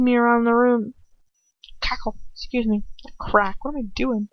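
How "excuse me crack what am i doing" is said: ordinary delivery, nothing marked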